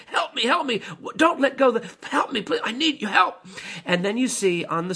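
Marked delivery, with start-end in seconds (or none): 0.1-3.4 high, urgent cries for help; desperate plea. Retold or imitated. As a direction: beg your partner not to let go. Climber begs partner for help.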